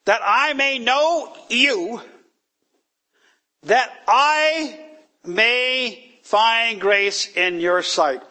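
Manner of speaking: high, slow, solemn reading of the verse; scripture reading. Acted. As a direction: read the scripture slowly and reverently, pitched high. Scripture read aloud.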